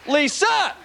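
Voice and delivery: high yell across the room; party yell. Retold or imitated. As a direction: call out to someone not there. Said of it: "they yell for fictitious women".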